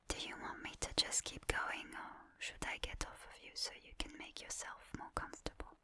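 ordinary talk, nothing marked

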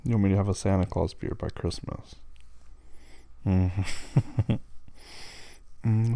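ordinary talk

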